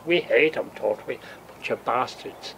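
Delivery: ordinary talk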